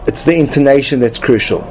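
usual speaking voice

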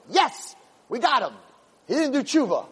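gloating tempter. Acted. Evil inclination crowing over his catch, gleeful and high. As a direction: gloat like a villain who has caught his prey. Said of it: "And the Yetzer Hara is like".

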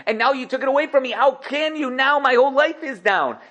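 hammed-up distraught king; Yosef's mock lament. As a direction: play a ruler pretending his magic cup was his whole life. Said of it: "He made it seem like he did nichush".

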